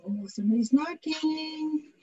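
ordinary talk

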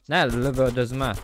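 ordinary talk

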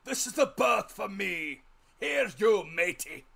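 loud, high, slow seaman's call; captain crying out. A narrator's reading. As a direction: call out to the barrow man like an old captain. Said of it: "he cried to the man".

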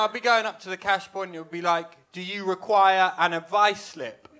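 usual speaking voice

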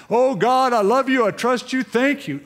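overjoyed mock gratitude; hammed-up joy at a Christmas bonus; gushing thanks.

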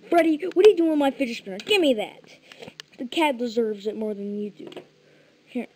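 loud, fast mock tantrum at Freddy; pretend angry yelling. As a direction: yell at him like he stole your toy. Yelling at Freddy over spinner.

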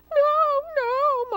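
high, loud, drawn-out wail of refusal; anguished cry. A narrator's reading. Mama Pig begs her youngest not to leave, crying out. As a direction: cry out like a distressed mother. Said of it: "Mama Pig cried".

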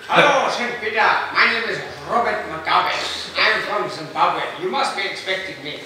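mock African statesman, loud and grand; lightly done Mugabe at heaven's gate. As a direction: introduce yourself with pompous pride. Mugabe introduces himself to Peter.

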